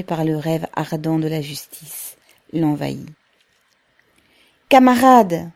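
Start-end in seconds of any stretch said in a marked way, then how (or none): 4.7-5.5 high, loud rallying call; energetic, enthusiastic. A narrator's reading. Pavel addresses the crowd of workers, loud and enthusiastic. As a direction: call out to comrades with fervour. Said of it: "de l'énergie et de l'enthousiasme".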